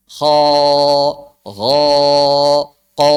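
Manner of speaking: echoing, drawn-out "Kho, gho, ko"; resonant thick letters. Shown as a demonstration.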